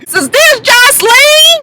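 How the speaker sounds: high, drawn-out squeal; mock over-excited. Imitated bartender meeting the star, mock excited squeal. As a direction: squeal the question in mocking imitation. Mimicking [name] meeting [name].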